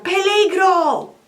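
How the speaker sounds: high, loud, slow alarm cry; frantic herdsman calling out danger; shouting danger. Acted. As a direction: cry out a warning to the village. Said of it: "empieza a gritar".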